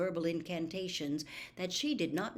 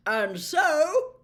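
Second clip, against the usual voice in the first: loud, high, hearty Slughorn; booming professor; 8 semitones higher, 10 dB louder than usual. Acted. Professor announcing to class, loud and hearty. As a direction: boom it out to the whole dungeon.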